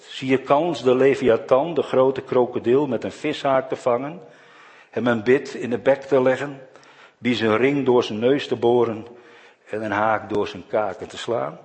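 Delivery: God challenging Job. Acted. God booming his challenge at Job. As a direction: thunder the questions down at Job.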